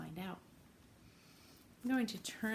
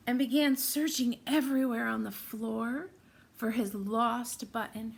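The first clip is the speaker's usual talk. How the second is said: lively read-aloud for little listeners; storytime narration; 4 dB louder, slower than usual. Acted. Teacher reading Corduroy to her class, lively storytime voice. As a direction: tell it like a picture book on the rug. Reads picture book to children.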